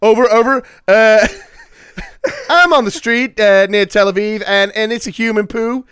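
loud, high cop reporting over the radio; police radio call. Pretend. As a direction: bark it into a walkie-talkie.

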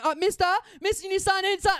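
high, loud calling after a customer; shop ladies calling. Retold or imitated. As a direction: pester him loudly to stop. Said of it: "hear the ladies from the I G A going".

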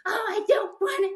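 high-pitched imitated child's protest; mock reluctant kid. Mom voicing kids who fight it, mock whining. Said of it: "they will fight it".

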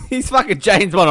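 ordinary talk, nothing marked

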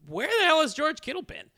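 exasperated. Real, not acted. Exasperated rhetorical question.

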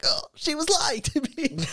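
high-pitched comic wail; hammed-up high whine of realisation; mock despair. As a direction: squeal it out as if crushed. Answers the inner voice, mock despair.

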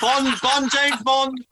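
high-pitched mock cheer through laughter; hammed-up fan yell; imitating a yelling fan. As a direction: mimic the nerd at the back, laughing. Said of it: "fat nerd guy at the back yelling".

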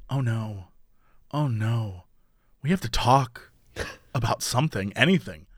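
low, hushed panic; panicked whisper-quiet. Retold or imitated. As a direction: mutter it in quiet dread. Panicked inner voice after hookup.

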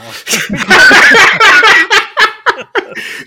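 big loud laugh, high and free; loud burst of laughter. Real, not acted. Laughing hard after joking about growing up in Cleveland, loud burst of laughter. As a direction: let a loud laugh burst out at your own joke. Host laughs at own joke.

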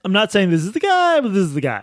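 coy high-pitched disclaimer dropping to a blunt aside; wink-wink fake denial; mock hedging. Mocking the article's hedging stance.

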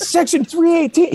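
laughing mock stage banter to an empty arena. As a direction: work the arena like a big stage host. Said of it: "And then he's like".

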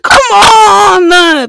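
high, loud, drawn-out cry of disbelief; exasperated high holler; exclaiming shout. Retold or imitated. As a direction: shout it with the last word stretched out. Exclaims over retold pregnancy secrecy.

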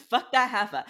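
laughing echo of a friend's insult. Real, not acted. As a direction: giggle it out as an echo. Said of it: "That's what we're saying".

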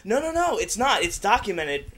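high, loud protest; insistent protest. Real, not acted. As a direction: insist it's true, loudly. Insisting story is real.

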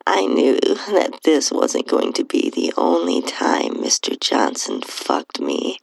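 ordinary delivery

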